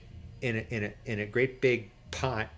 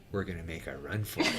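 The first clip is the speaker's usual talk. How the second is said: low, much softer and fast, quick and conspiratorial; hushed announcement; 9 semitones lower, 10 dB quieter, faster than usual. Retold or imitated. Fisherman quietly telling the crew they'll flee, hushed and fast. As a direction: tell the crew the plan under your breath.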